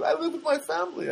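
high-pitched mock girl voice; put-on high girlish voice; young Armenian girl. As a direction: imitate a young woman answering. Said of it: "there's this Armenian girl there".

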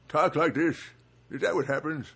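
put-on elderly voice; mock old-timer voice; really old person. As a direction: imitate someone who has grown really old. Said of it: "like, really old".

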